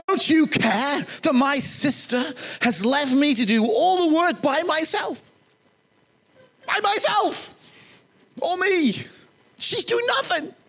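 shrill protest to Jesus about her sister; Martha's complaint. Retold or imitated. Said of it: "she said this".

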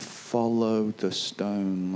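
eyes tracking the stone slowly; slow-motion follow. Retold or imitated. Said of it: "you kind of went".

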